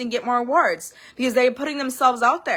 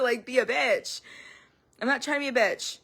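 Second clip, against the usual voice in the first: ordinary delivery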